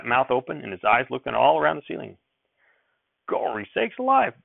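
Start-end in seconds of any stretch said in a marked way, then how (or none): 3.3-4.3 surprised exclamation. Acted. Grandfather startled by flies.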